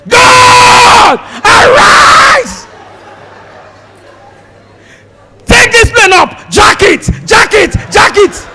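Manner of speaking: high, loud desperate shouted prayer; frantic prayer. Retold or imitated. Passengers praying in panic as the plane falls, frantic shouting. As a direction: beg God to lift the plane. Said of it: "If you hear prayer".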